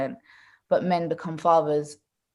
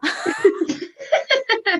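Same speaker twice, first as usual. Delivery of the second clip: squealing laugh, much higher; real laughter at the end of the story; bright high laughter. Real, not acted.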